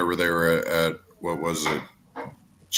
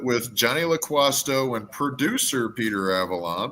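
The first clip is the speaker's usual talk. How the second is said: ordinary talk, nothing marked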